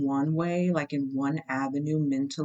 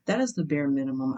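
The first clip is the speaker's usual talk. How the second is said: ordinary talk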